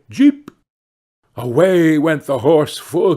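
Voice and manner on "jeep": high, loud call of Jeep; cried Jeep. A narrator's reading. Hans crying Jeep to make the horse gallop. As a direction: urge the horse on with a sharp Jeep. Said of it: "he smacked his lips and cried".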